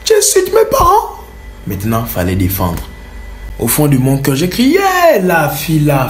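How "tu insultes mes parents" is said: high, aggressive bandit challenge; imitating the bandit. Bandit accusing the girl of insulting his parents, high aggressive. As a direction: pick a fight out of nothing.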